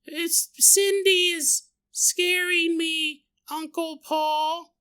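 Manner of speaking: put-on high, slow, small child's voice; a timid kid telling her uncle; scared little girl. Niece Samantha tells Uncle Paul, high and slow childlike.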